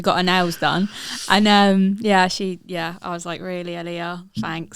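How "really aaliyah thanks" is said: sarcastic. Retold or imitated. Mum sarcastic at daughter.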